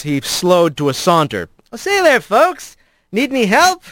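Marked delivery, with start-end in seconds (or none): 1.7-3.8 high, breezy showman's offer; smooth Ratayo act. Acted. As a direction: play the charming rogue offering help. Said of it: "Smoothing his Ratayo face into place".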